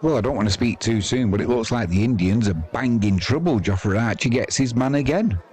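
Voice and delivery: usual speaking voice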